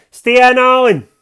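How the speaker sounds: high, loud scream from another room; screaming mother. Acted. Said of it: "My ma's screaming for her bedroom".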